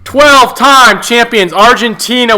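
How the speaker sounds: high, loud champion introduction; grand announcer reveal. Pretend. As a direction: proclaim the champions like a big-event announcer. Mock-grand reveal of drawn champions.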